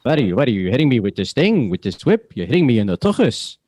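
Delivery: put-on Yiddish-flavored New York accent.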